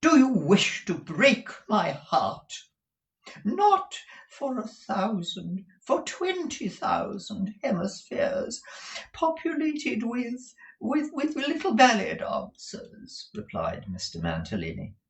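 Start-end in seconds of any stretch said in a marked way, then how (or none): 0.0-2.5 high, loud, slow reproach; drawn-out theatrical plea; wounded wife. Acted. Wife reproaches her flirting husband, high and wounded. 3.5-13.3 very high, slow rapture; poetical strain. A narrator's reading. Husband woos his wife back, high poetical gushing. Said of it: "in a poetical strain".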